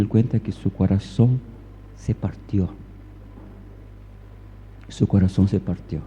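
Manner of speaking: low, soft, with long pauses; understated heartbreak. Retold or imitated. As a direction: let the voice sink quietly on the father's pain. Retelling a father's heartbreak.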